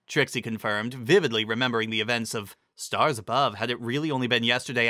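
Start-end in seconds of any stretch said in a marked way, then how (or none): none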